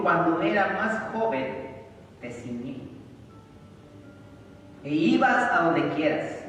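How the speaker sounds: loud, high, slow proclamation of Jesus' words; full-force preaching. Acted. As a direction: thunder out the prophecy slowly. (proclamación fuerte y lenta)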